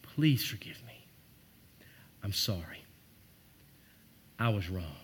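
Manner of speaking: hushed heartfelt apology face to face; whispered sincere plea. Shown as a demonstration. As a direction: look them in the eyes and breathe it. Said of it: "Look that person in the eyes and say".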